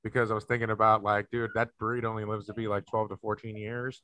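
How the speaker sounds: soft, low voice weighed down by worry; hushed, downcast confession; subdued, sad. Real, not acted. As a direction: confide it quietly, dwelling on losing the dog. Tearful topic, dog's short life.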